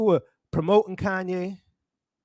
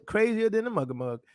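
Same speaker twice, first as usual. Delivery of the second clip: ordinary talk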